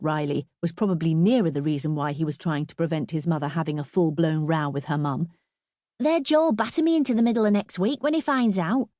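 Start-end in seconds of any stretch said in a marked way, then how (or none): 6.0-8.9 high, worried schoolboy; high-pitched lad warning his mum; pleading boy. A narrator's reading. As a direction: plead in a young boy's voice. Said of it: "still pleading with his mother".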